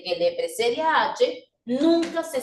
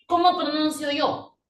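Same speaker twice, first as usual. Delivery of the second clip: ordinary delivery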